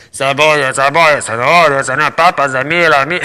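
loud sing-song hawker calling out in Spanish; street vendor call. Retold or imitated. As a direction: cry out your wares like a street seller. Vendor returns calling onions.

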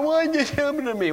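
high-pitched, hurried mock whine. Preacher mimics Christians who feel persecuted, mock whining. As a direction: imitate a self-pitying complainer. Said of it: "the way some Christians are".

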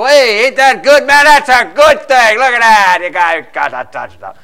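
put-on a drunk home fan gloating at the rival fan beside him. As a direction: rub it in his face with a grin. Gloating fan elbows you.

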